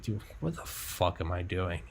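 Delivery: quiet low mutter of self-doubt; despairing self-talk. Retold or imitated. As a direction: mutter it to yourself, defeated. His own despairing self-talk.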